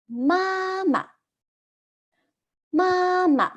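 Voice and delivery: 妈妈 said high, level and clear, two even syllables; teacher model word. Shown as a demonstration. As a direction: model 妈妈 for students to copy. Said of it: "How to say mother in Chinese".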